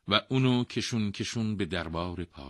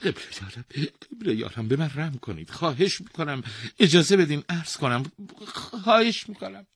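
high pleading that builds louder; begging; 10 semitones higher, 6 dB louder than usual. Acted. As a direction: beg the king for mercy. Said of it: "بنا به التماس افتاد".

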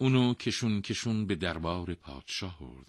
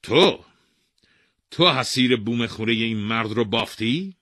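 loud, high accusing question; king accusing the mat weaver; stern king questioning; 5 semitones higher, 10 dB louder than usual. Acted. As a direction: demand an answer.

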